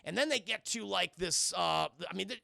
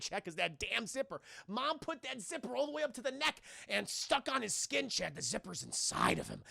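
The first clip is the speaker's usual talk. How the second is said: ordinary delivery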